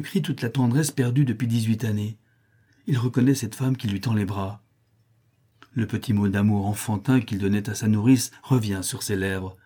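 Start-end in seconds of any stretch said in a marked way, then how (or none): none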